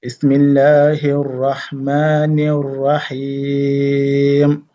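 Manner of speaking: slow, drawn-out chanted recitation; Quranic recitation. Shown as a demonstration. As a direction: recite it slowly, stretching the vowels. Recites surah again after encore.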